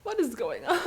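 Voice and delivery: laughing out the question in real disbelief. Real, not acted. Streamer reacts to the dragon romance with real laughter.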